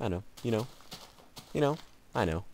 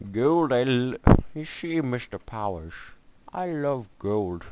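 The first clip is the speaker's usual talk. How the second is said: higher-pitched movie-villain parody voice; Goldmember impression; 4 semitones higher, 7 dB louder, slower than usual. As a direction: relish it like a cartoon villain, drawn out at the end. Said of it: "Austin Powers and Gold".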